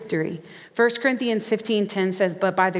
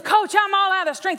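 much higher, louder, a strained cry of giving up; exhausted player pleading; 12 semitones higher, 3 dB louder than usual. Acted. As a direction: beg the coach, worn out. Exhausted player begs coach.

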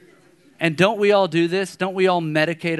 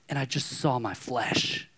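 usual speaking voice

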